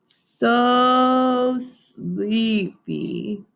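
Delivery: drowsy drawn-out bear, drifting off; sleepy mumble. Acted. Bear drifting into his dreams, slow sleepy mumble. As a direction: let it fade like falling asleep. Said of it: "mumbled Bear".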